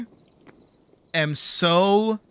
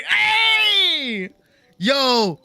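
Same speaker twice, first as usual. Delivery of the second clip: excited shout; 10 semitones higher than usual. Pretend. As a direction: holler it like greeting a buddy. Calling to kid, shouting.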